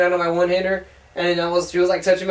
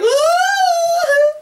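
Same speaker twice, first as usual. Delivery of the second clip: high, held 'ah' of adoration; mock lovestruck high cry; swooning squeal; 4 dB louder than usual. Joking about his bromance, high swooning squeal. Acting out his man crush.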